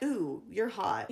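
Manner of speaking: slow, low, softly smitten; mock flirtation. Mimicking a smitten man to the freaked-out woman, mock flirtation. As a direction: croon it low and drawn-out, imitating a lover.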